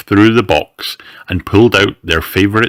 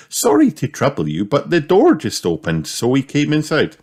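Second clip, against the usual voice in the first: higher-pitched polite mother pig; apologetic Mummy Pig; 7 semitones higher than usual. Acted. Mummy Pig apologises to the Bears for coming in, apologetic. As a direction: lift the voice into an apologetic storybook mum.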